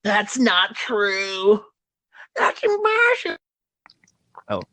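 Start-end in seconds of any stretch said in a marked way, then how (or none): 0.0-3.4 mock Luke crying out in disbelief; Luke's anguished wail. Quoting the movie scene as a higher wailing Luke. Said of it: "McClunky is your father".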